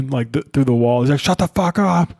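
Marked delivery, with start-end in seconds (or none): 1.2-2.1 higher mock parrot squawk; a parrot parroting a screaming neighbor. Parrot repeats the neighbor's yelling, high squawk. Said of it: "a neighbor who's screaming through the wall".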